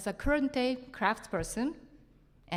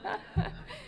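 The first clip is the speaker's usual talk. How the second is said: high giggle; 13 semitones higher, 3 dB quieter than usual. Real, not acted. Laughs about tattoo.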